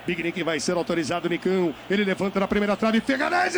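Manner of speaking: fast building play-by-play; live play-by-play. Acted. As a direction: call the set piece quickly.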